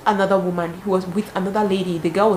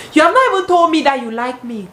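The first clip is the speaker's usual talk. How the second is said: indignant; 7 semitones higher, 5 dB louder than usual. Retold or imitated. Repeated with more force.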